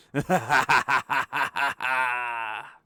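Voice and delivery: loud, long villainous laugh; evil cackle. Acted. Game master as trap voice mocking the party, loud evil cackle. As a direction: cackle on and on in staccato bursts. Trap voice gloats after its threat.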